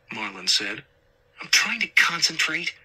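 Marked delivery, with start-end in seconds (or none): 1.4-2.7 irritated. A narrator's reading. Marlon snapping at distracting partner.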